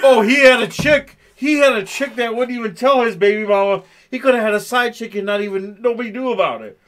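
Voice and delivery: loud, high bro gossip; mock the coworkers gossiping loudly.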